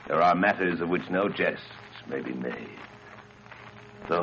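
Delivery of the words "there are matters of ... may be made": plain reading voice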